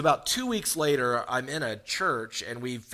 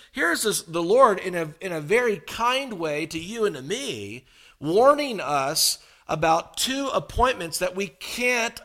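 higher, louder preacher build; preaching; 6 semitones higher, 4 dB louder than usual. Real, not acted. Pastor warning the church of two appointments, preaching with force. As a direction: drive the point home to the congregation.

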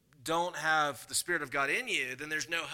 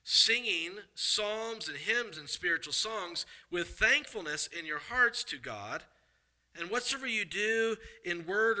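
slow, measured reading of the verses; scripture reading; 3 semitones higher than usual. Acted. Preacher reads Colossians to church, slow and measured. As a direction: read the passage out slowly to the congregation.

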